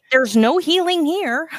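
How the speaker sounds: high, loud mock scold; high, loud hammed-up store clerk.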